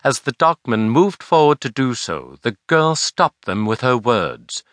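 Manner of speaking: usual speaking voice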